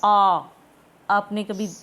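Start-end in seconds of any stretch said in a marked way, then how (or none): 0.0-0.5 आ said loud and long, mouth fully open; teacher showing a fully open-mouthed आ; wide open vowel. Shown as a demonstration. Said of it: "पूरा मुख खुला".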